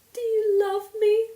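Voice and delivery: high, loud, tearful question; emotional cry. A narrator's reading. Anne begging to know if Diana loves her, tearful cry. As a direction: plead it out in a cry.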